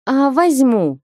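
bright ringing yes; ringing reply. A narrator's reading. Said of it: "звонко ответила Марика".

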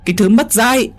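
wailing high-pitched shouts to the absent husband, very fast; shrieking tirade. Acted. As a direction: scream the house down like an outraged stepmother. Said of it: "hét um trời".

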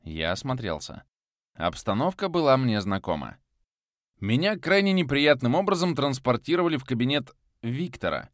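ordinary delivery, nothing marked

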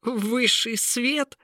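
high, slow derisive question; scornful incredulity. Acted. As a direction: echo it back as if laughable. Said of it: "захохотал принц". (насмешливое удивление)

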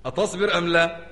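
ordinary delivery

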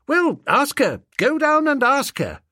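put-on high, urgent young gentleman; insistent toff. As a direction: urge it like a peeved aristocrat. Bertie demands.

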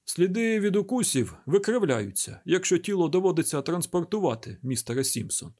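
ordinary delivery, nothing marked

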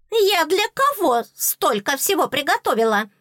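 high, loud screeching little fairy. Acted. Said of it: "продолжала верещать зубная фея".

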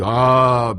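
loud drawn-out sighing ah; theatrical ah of weariness; long dramatic sigh. Acted. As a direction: groan at the memory. Scientist recalls hard nights.

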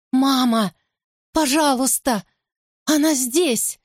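high desperate plea; terrified child cry. Acted. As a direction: cry for mum in terror. Child screams in terror.

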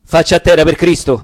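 barked order. Acted. Patriot ordering the caught peasant down, loud command. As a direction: pin him down with a loud order. Ambushing the vineyard keeper.

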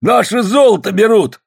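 high, loud cry of grievance; outraged shout. A narrator's reading. Prospector to the crowd over stolen gold, outraged shout. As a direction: raise the alarm, high and indignant.

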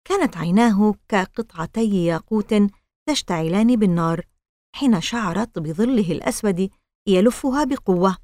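usual speaking voice

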